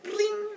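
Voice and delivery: high ringing bling; high cartoon strum; plucked string noise. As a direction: imitate a string twang. Kid plucks the lyre.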